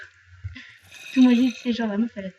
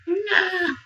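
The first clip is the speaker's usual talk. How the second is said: mock cry; 8 semitones higher, 4 dB louder than usual. Mock despair at game mishap.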